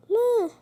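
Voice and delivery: high, loud wail of pretend grief; mock anguished cry. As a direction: cry out no in play despair. Grief at princess death. (صرخة حزن تمثيلية عالية)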